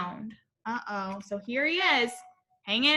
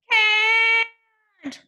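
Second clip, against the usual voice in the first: high, loud wailing cry; frightened cry; 8 semitones higher, 7 dB louder than usual. A narrator's reading. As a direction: wail it as the tree falls. Kevin crying out.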